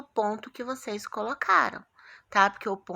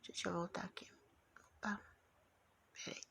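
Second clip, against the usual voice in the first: whisper; 3 semitones lower, 19 dB quieter than usual. Real, not acted. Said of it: "whisper heard".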